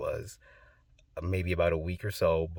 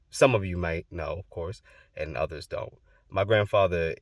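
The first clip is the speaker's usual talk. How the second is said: usual speaking voice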